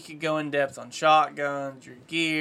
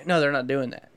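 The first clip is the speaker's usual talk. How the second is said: ordinary talk, nothing marked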